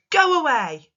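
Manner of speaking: sharp, loud bossy order; bossy command. Shown as a demonstration. Teacher demonstrating a command to pupils, bossy and loud. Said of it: "makes us say it in a bossy way".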